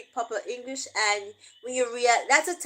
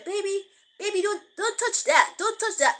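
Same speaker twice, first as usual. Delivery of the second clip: higher, sing-song warning in mock polished English; proper English to a baby; 7 semitones higher than usual. Parent warning a baby in proper English, lightly higher. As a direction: coo a careful warning at the toddler. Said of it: "Jamaican don't talk like that".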